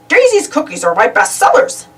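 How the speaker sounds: proud shopkeeper praising Daisy; baker voice. A narrator's reading. Baker boasting to Donald about Daisy's cookies.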